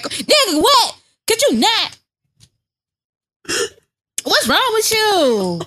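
high indignant squeal with laughter; voicing a fed-up wife, shrieking and laughing. Wife shrieking at husband in bed, high indignant outcry. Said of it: "he be trying to fuck her".

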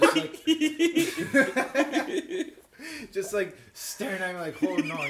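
high, loud laughter through the words; cracking up while telling it; laughing hard. Real, not acted. Laughing retelling chipmunk on seat.

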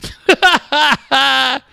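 high-pitched laugh; real laugh at a friend's quip; burst of laughter. Real, not acted. Co-host jokes you needn't name him, burst of high laughter. Laughs at co-host's joking remark.

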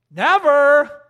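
high, loud NEVER; booming denial; loud emphatic never. Real, not acted. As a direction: slam the answer down. Emphatic answer to own question.

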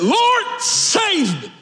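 Peter; very high, drawn-out cry for rescue; desperate cry. Retold or imitated. Imitate a desperate shout, long on each word. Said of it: "he cries out. He was a little desperate".

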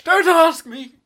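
high, very loud indignant shout; sudden outburst. Acted. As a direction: explode in a high-pitched protest. Outburst at being told to try.

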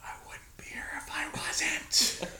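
whispered, under the breath; a sarcastic whispered aside; snide whisper. Retold or imitated. Retold snide aside to the clerk.

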